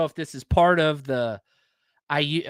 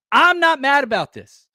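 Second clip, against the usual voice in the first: excited; 9 semitones higher, 6 dB louder than usual. Real, not acted. Excited about idea.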